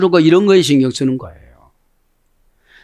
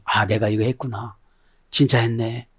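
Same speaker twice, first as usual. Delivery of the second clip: low, softened aside to oneself; acted realisation; 4 semitones lower, 7 dB quieter than usual. Preacher voicing someone noticing their own deed, quietly reflective. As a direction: lightly imitate a private realisation. Quotes a person's private realisation.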